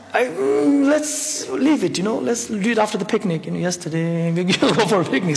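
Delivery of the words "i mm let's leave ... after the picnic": nervously dodging the talk; anxious stalling. Retold or imitated. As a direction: put it off, pleading a little.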